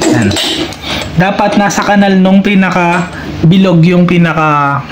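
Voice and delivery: ordinary talk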